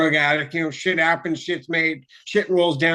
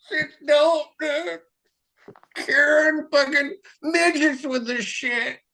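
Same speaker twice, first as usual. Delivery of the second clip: mock health-nut dude; 10 semitones higher than usual. Health nut bragging about organic food, high mocking voice. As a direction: whine it like a clueless health fanatic.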